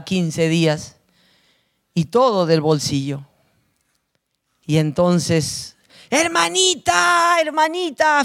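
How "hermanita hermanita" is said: joyful calling out. Retold or imitated. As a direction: shout it across the prison yard to a beloved sister. Prisoners greeting her at gate.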